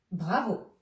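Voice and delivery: ordinary talk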